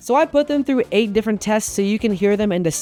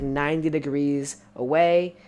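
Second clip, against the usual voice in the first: normal talking voice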